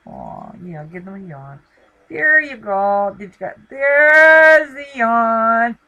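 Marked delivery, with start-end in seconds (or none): 2.1-5.8 loud, slow, delighted shout; delighted cheer. Real, not acted. Host cheering her dog's yawn, loud delighted shout. Dog finally yawns on camera.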